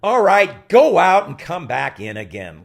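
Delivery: loud, slow command; impatient director order. Retold or imitated. Director sending the actor out again, loud. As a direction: bark the order to reset. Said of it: "Hal looks".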